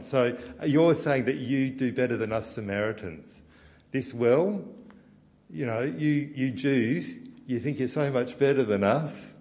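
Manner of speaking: loud, high, defiant protest; defiant rant. Acted. Samaritan woman telling Jesus they're just as good, loud and defiant. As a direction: stand up for her people, loud and proud.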